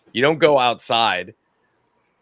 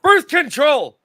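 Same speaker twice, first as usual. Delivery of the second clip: loud high exclaim; shouting; 8 dB louder, slower than usual. Acted. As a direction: blurt it out loud. Shouted catchphrase opener.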